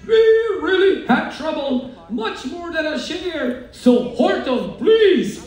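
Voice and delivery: high, pleading little mayor; mayor's cry. A narrator's reading. Mayor on the speck begging Horton, crying out. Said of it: "cried a voice of the mayor's".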